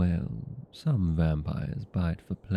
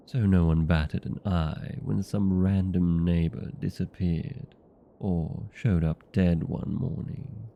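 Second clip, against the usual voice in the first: ordinary talk, nothing marked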